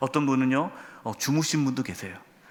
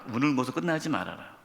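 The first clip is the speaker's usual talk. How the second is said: quiet repeat; 4 semitones lower, 7 dB quieter than usual. Shown as a demonstration. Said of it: "랍니다".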